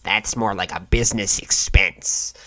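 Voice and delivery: a slow, lightly voiced rich scalper; smug scalper. Scalper mocking the fee as a business expense, slow smug voice. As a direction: shrug it off like pocket change, slowly. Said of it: "they're like".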